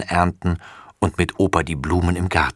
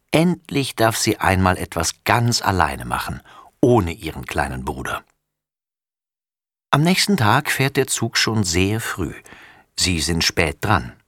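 ordinary delivery

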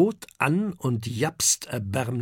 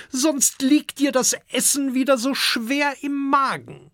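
dragon mother warning; 11 semitones higher than usual. A narrator's reading. Dragon mother warns husband.

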